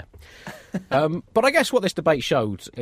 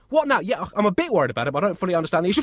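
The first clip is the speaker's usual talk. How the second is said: distracted parent; 7 semitones higher, 3 dB louder, faster than usual. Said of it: "You're probably gonna hear them say".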